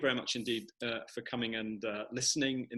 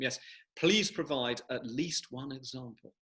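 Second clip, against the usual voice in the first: ordinary talk, nothing marked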